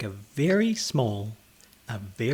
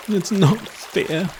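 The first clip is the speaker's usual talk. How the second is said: high, slow, louder wail; 7 semitones higher, 4 dB louder, slower than usual. Acted. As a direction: whine it like a sulking child. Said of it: "he wailed".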